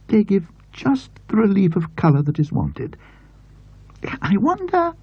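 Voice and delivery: put-on high, enthusing elderly aunt; aunt's voice. A narrator's reading. Aunt delighting over the fabric to James, enthused. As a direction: gush over the pattern as the aunt.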